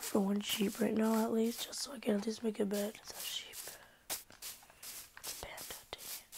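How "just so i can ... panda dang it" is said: fast hushed whisper; whisper, quick and disappointed. Real, not acted. Searching for sheep.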